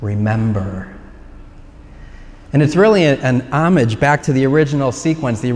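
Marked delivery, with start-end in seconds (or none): 0.0-0.9 low, drawn-out word from a film trailer; mysterious voice. Retold or imitated. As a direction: imitate a solemn voice from the sky. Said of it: "And the voice says".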